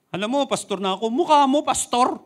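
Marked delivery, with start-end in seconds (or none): 1.1-2.2 raised, loud sneering jab; loud mock scoff. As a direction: scoff at the would-be pastor. Mocking critic voiced. (pangungutya sa nagpapanggap na pastor)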